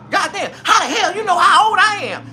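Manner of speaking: high, loud outraged lady; hammed-up indignant woman; 1 mock outraged woman. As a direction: shriek it, offended. Woman retorts in retold scene.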